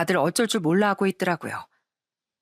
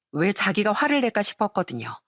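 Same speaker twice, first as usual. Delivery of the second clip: ordinary delivery, nothing marked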